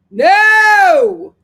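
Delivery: a loud, high, flat refusal; shouted no. Pretend. To viewers, refusing dry British drama: a shouted no. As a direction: bark the no out loud. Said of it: "rejecting British drama".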